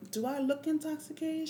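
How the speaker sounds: very high, loud incredulous question; indignant challenge. Retold or imitated. Patient challenges hospital staff about drunkenness, high indignant voice. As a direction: demand an answer in outraged disbelief.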